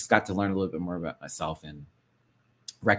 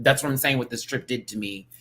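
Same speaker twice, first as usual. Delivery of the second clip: ordinary delivery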